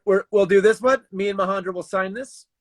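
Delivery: ordinary talk, nothing marked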